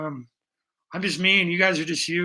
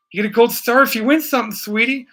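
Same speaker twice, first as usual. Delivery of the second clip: put-on higher, louder, faster, like praising a child; mock sweet praise; 5 semitones higher, 7 dB louder than usual. Streamer teasing a viewer about a gold star, mock sweet praise. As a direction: hand out the gold star like a kindergarten teacher.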